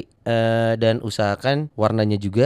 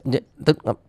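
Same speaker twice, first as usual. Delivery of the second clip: glitching audio. Pretend. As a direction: fake signal dropouts, syllables only. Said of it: "Putus-putus?".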